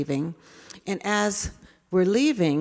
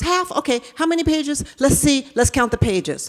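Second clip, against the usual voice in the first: put-on higher, fast excited kid begging; eager child; 6 semitones higher, 4 dB louder than usual. David begging the teacher to read, higher and fast. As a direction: rush it like a boy who wants a story now. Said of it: "And he goes".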